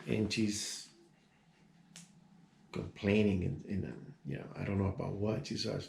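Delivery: usual speaking voice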